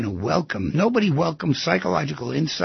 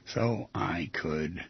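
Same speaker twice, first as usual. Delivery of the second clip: slow, quiet, low dictation; soft, spaced-out words for note-takers; 9 semitones lower, 10 dB quieter, slower than usual. Shown as a demonstration. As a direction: spell out the phrase slowly, pausing before the last words. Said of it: "These exact words".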